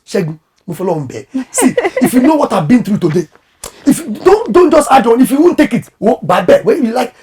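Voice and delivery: half-laughing indignant retort; retold insulting wife. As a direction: snap back at a demanding husband after a long day. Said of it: "now she's insulting her husband".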